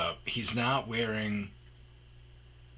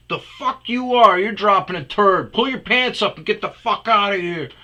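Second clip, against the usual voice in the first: much louder and higher angry yell; furious shout; 9 semitones higher, 11 dB louder than usual. Retold or imitated. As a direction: chase him off with a bellow. Shouting at man.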